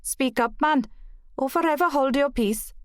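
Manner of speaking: put-on playful overdone Welsh accent; exaggerated Welsh accent. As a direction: ham up a Welsh wife's scolding. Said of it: "She exaggerated her Welsh accent".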